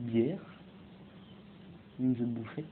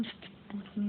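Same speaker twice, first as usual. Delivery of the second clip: high but much softer repeat; soft taunting echo; 5 semitones higher, 10 dB quieter than usual. Pretend. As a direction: echo the boast quietly, keeping it high. (écho moqueur, aigu et tout bas)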